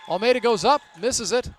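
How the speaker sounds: thrilled live commentary of the closing seconds; excited play-by-play. Real, not acted. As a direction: burst out loud on the turnover, then ease off. Calls the closing turnover.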